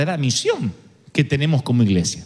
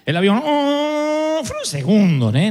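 ordinary talk, nothing marked

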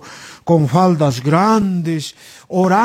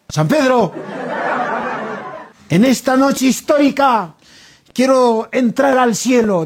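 higher-pitched oratory with pauses; grand politician's speech; 4 semitones higher than usual. Acted. Alan asks San Pedro to let him into heaven, grandly. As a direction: address heaven like a campaign crowd.

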